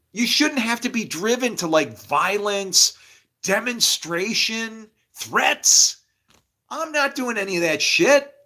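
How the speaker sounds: high, heated, insistent protest; exasperated rant. Real, not acted. Speaker refusing to be driven to violence, heated rant. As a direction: protest loudly, stretching the key words.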